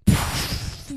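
long breathy puff; imitated explosion. Host showing a mind blown, imitated explosion puff.

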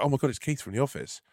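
gleeful. Retold or imitated. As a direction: sell the fan's delight at spotting Keith. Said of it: "The guy's face was glee".

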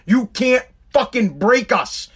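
normal talking voice

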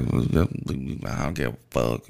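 a lightly voiced surly old man; mocking rude old man. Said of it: "he talk like this".

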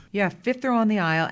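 Brooklyn accent. Real, not acted. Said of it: "That's what happens when I talk about Brooklyn".

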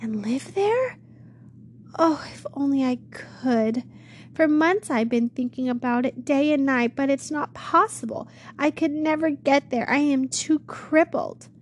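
put-on a scared young woman pouring out her fears; frightened girl.

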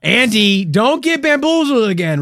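high, loud comic scolding; urgent warning. Acted. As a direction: shout a warning to a gullible friend. Urgently warning a fooled friend.

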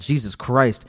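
normal talking voice

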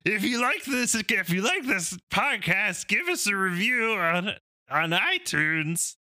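high, slow strained comic voice; Bobcat Goldthwait impression. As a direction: squeeze it out high and drawn. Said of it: "that was my Bobcat Goldthwait".

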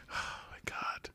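breathy shocked whisper. Retold or imitated. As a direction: whisper it in disbelief. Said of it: "I was like".

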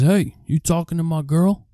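put-on jealous boyfriend calling out a rival; jealous jock.

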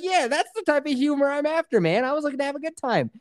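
high, fast sarcastic voice; mock sarcastic. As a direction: sneer it out in a high voice. Mocking what the chef wanted.

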